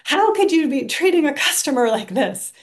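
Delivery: loud, high indignant yelling at the agent; angry outburst. Retold or imitated. Angry caller yelling at the customer service lady. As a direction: reenact your own outburst at the service rep.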